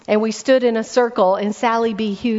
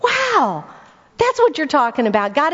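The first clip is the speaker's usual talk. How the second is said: amazed wow; 4 semitones higher than usual. Retold or imitated. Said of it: "I was like".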